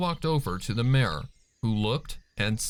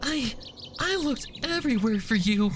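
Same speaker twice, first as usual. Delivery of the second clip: much higher, choked with tears; tearful; 13 semitones higher than usual. Acted. Fluttershy clings to Angel, stammering through tears. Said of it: "her face covered in tears".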